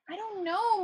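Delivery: high plaintive moan; a mild moan of dismay. Acted. Two Flower moaning to Rincewind about the fire. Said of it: "moaned Two Flower".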